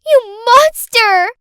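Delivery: high, loud, drawn-out accusing yell; child's shout. Acted. Girl turns on her carer before running upstairs, shouting. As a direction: hurl it out like a furious little girl. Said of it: "Clarice shouted".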